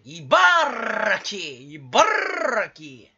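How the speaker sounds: 茨城 with a long, forceful rolled R; rolled-R shout. Acted. Streamer hams up the name 茨城, heavy rolled R. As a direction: draw out the trill in 茨城. Repeats comic shout of prefecture.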